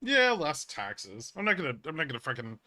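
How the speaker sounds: ordinary talk, nothing marked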